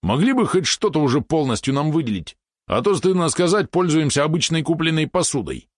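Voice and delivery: high-pitched indignant complaining. A narrator's reading. Valeron grumbles about having only store-bought cookware, indignant. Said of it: "возмутился он".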